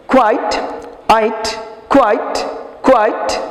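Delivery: কোয়াইট said clearly as kwait, ending in -ait; pronunciation model. Shown as a demonstration. Teacher modelling কোয়াইট for pupils to repeat. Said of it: "এই উচ্চারণ কিন্তু সমস্যা".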